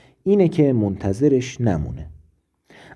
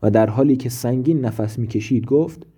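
ordinary delivery

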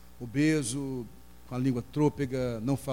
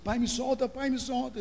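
put-on crying little boy; 12 semitones higher than usual.

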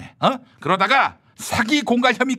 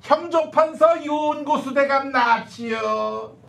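court herald; 5 semitones higher, much slower than usual. Acted. Court herald announcing minister.

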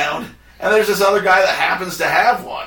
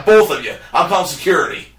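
ordinary delivery

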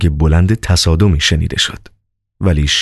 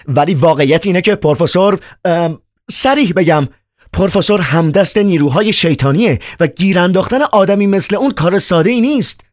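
put-on much higher, agitated young man; Ivan's voice; 12 semitones higher than usual. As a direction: play Ivan, high and worked up. Narrator voices Ivan.

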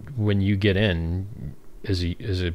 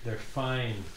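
hushed show-off voice; 4 semitones higher, 9 dB quieter, much slower than usual. Pretend. Showing off shorts on stream.

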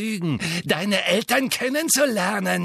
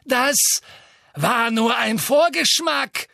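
higher, louder slow gloat; Bellatrix gloating; 11 semitones higher, 5 dB louder, slower than usual. A narrator's reading. Bellatrix gloating over Neville, slow cruel gloat. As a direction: savour the cruelty.

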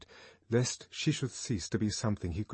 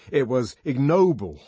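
loud indignant outburst; 5 semitones higher, 10 dB louder than usual. Acted. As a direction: condemn it with indignation. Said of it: "he said indignantly".

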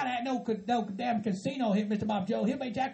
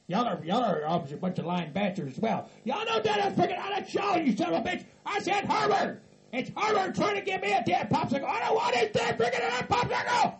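furious hillbilly rant; 7 semitones higher than usual. Acted. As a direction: yell threats at the pranksters.

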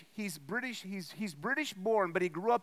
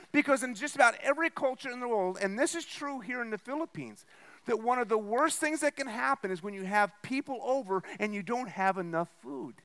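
ordinary delivery

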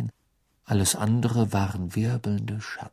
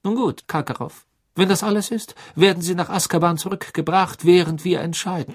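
stern judge; 8 semitones higher, 3 dB louder than usual. A narrator's reading. Judge dismissing the prisoner.

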